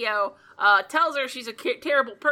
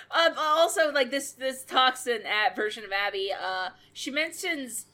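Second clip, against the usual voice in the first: normal talking voice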